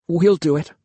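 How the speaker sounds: ordinary delivery, nothing marked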